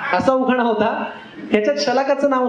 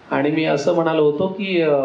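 ordinary delivery

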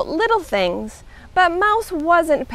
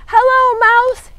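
high, loud calling voice; yelling; 11 semitones higher, 6 dB louder than usual. A narrator's reading. Rabbit calling down to Mouse, yelling. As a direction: holler down into a hole. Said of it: "he yelled".

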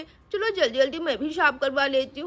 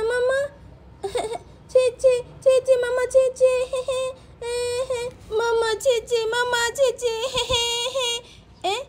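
put-on high, whimpering toddler calling for mummy; baby girl voice; 6 semitones higher, slower than usual. Little girl calls mummy from the bathroom, whiny baby voice. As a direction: babble like a tiny child with giggles and little cries.

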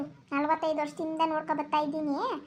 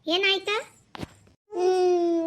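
ordinary delivery

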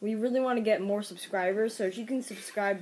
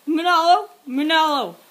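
loud, raised-pitch call; 4 semitones higher, 9 dB louder than usual. Acted. Parent hollering for Manolo, loud calling out. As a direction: holler the name urgently.

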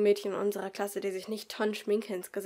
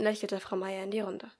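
plain reading voice

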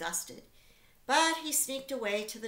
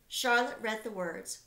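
usual speaking voice

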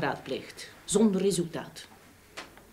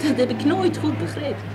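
higher and louder, baffled indignation; exasperated; 9 semitones higher, 5 dB louder than usual. Acted. Wife telling the detective about refused adoption, exasperated. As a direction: throw up your hands in disbelief.

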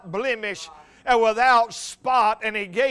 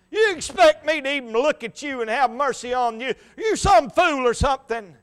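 raised, louder voice of mock condemnation; scornful rebuke; 4 semitones higher, 4 dB louder than usual. Religion answers the begging thief, in scornful rebuke. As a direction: scold the sinner from on high. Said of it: "That's what religion would say".

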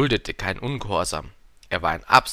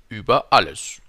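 ÜBER ALLES louder, falling, plainly stated; König, große Einfachheit; 8 dB louder than usual. A narrator's reading. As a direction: say Über alles as a loud, simple royal fact. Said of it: "antwortete der König mit großer Einfachheit". („Über alles“ laut und fallend festgestellt)

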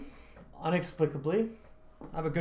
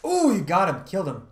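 excited; 6 semitones higher, 8 dB louder than usual. Real, not acted. Teammate gets a kill.

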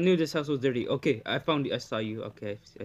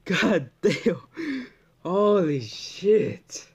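scared; 4 semitones higher, 6 dB louder, much slower than usual. Real, not acted. After jump scare.

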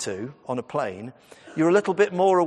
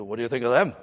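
put-on Welsh teenager; 3 semitones lower, faster than usual. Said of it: "attempting your accent".

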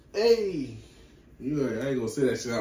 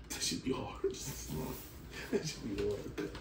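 whispering; 3 semitones lower, 11 dB quieter than usual. Real, not acted. Whispered unvoiced aside.

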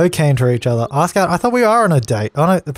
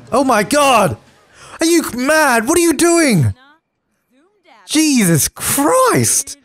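high, startled cry of disbelief; real shocked squeal at the game; shocked outburst; 10 semitones higher, much slower than usual. Real, not acted. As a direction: yelp it like you can't believe your eyes. Sim mows down the date.

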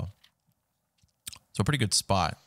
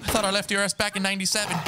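mock taunt; 11 semitones higher, faster than usual. Taunting the tiger.

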